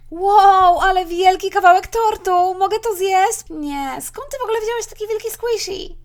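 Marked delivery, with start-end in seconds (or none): 0.1-3.4 marvelling. Acted. Mother marvels at cake slice.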